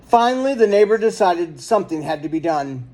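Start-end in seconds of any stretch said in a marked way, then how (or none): none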